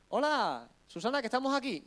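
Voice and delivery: high-pitched visitor's call at the door. Acted. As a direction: call out hello to a friend upstairs. Acts visitors calling from the door. (llamando en voz alta a la puerta)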